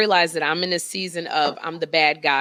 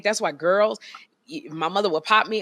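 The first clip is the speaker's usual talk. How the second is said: normal talking voice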